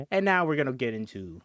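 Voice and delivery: ordinary talk, nothing marked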